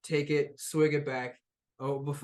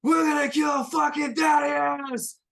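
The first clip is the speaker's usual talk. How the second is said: much higher, full battle cry; rallying shout; 14 semitones higher, 8 dB louder than usual. Acted. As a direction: yell it to the crowd as the gun goes off. Lawrence rallies crowd firing gun.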